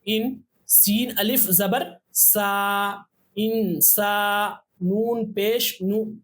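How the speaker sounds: ता अलिफ ज़बर ता, each part spaced and slow; slow spelling drill. Shown as a demonstration. Teacher spelling letters for practice, slow and clear. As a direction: spell it out slowly for learners. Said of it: "यहाँ हमजा नून जेर".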